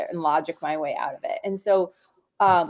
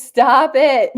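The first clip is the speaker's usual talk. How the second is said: loud, high 'stop' through laughter; real giggly, raised-voice objection; laughing protest; 6 semitones higher, 9 dB louder than usual. Real, not acted. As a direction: crack up while telling someone off. Speaker laughs at interruption mid-answer.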